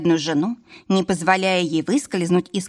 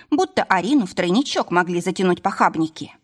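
usual speaking voice

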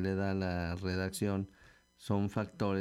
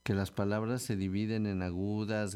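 ordinary delivery

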